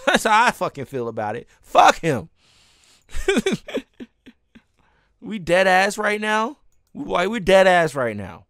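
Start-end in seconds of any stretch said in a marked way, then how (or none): none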